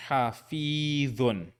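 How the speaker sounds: hafidhun in one slow run; joined, slow. Shown as a demonstration. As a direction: blend hafidhun without pauses. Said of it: "without pauses this time".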